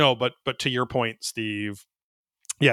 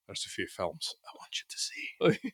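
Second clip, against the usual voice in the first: whispered, soft lightly Scottish line; a hushed, half-hearted Scottish try; Scottish accent; 2 semitones lower, 11 dB quieter than usual. Retold or imitated. Guy recommending films, redone in a whispered Scottish accent. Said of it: "but with a Scottish accent".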